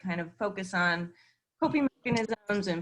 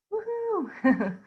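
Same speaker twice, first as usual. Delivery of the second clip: high, drawn-out whoop; happy cheer; 12 semitones higher than usual. Real, not acted. Host welcoming people joining from afar, a high happy cheer. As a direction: cheer in delight, voice leaping up. Cheering as participants join the session.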